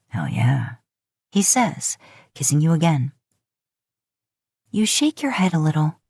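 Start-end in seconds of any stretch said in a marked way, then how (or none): 0.1-0.8 very low whispered reply. A narrator's reading. Man confirms to his lover, very low whisper. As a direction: breathe it low and sure.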